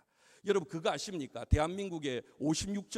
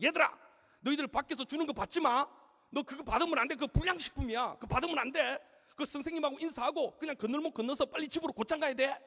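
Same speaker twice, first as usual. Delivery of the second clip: higher-pitched, imitated teacher warning the kids; teacher's scolding; 6 semitones higher than usual. Second-grade teacher warning pupils off handouts, imitated scolding. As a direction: rattle off firm orders like a scolding teacher. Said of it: "그렇게 야단을 치고".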